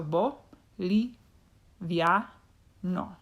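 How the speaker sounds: "bo, li, via, no" split with pauses; each syllable set apart, "via" as one. Shown as a demonstration. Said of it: "all of these successfully end in vowels".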